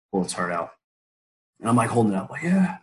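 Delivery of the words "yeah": triumphant yeah. Retold or imitated. Holding duck heart up.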